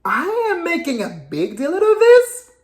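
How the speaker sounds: high, incredulous echo of his words; indignant disbelief. Real, not acted. Indignant repeat of accusation.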